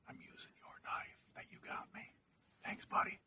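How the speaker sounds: hushed whisper in the blind. Real, not acted. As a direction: whisper so the deer won't hear. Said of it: "my wife's telling me to be quiet".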